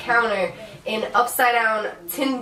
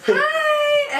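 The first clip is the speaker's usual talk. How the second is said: put-on squealed high baby-talk hi; cutesy dog greeting; 3 dB louder than usual. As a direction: sing it up high to a puppy.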